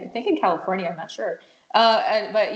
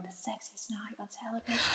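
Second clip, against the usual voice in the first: lightly voiced sultry announcer, much softer; mock sultry ad voice; 3 semitones higher, 10 dB quieter than usual. Host imitates a Victoria's Secret ad, softly and sultry. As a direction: breathe it like a lingerie ad. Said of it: "You know, like that".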